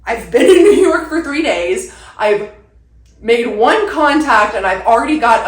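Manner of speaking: laughing with excitement; bursting with glee; excited. Real, not acted.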